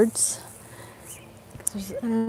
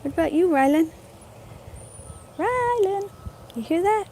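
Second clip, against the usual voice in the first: pet talk; 9 semitones higher, 3 dB quieter than usual. Real, not acted. Talking to her dog Rylan.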